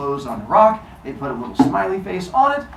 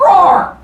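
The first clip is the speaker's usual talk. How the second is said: high, loud, drawn-out play roar; mock battle roar; 11 dB louder than usual. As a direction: roar like a would-be hero charging in. Zeus acts out attacking roar.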